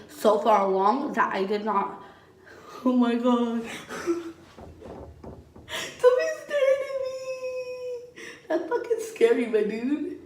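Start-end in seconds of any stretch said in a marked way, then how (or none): 3.7-4.3 short soft high giggle. Real, not acted. Caught off guard by someone watching, giggling. 6.0-7.2 much higher, fast, half-laughing; startled protest. Real, not acted. Telling someone off camera to stop staring, startled high protest. 8.5-10.2 said through a real laugh. Real, not acted. Joking with the onlooker, laughing.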